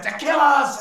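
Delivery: rally the tribe with a roaring speech; triumphant orc warlord bellowing to his warriors; orc chieftain declaring. Acted. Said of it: "as the orc said".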